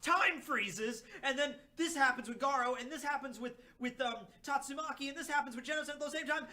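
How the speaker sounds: high-pitched gushing play-by-play; breathless fanboy recap; excited rambling. Retold or imitated. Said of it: "my discussion of that chapter would just be like".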